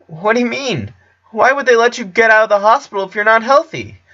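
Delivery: put-on high boyish voice, puzzled; a kid who can't make sense of it; high anxious question; confused Clyde. A narrator's reading.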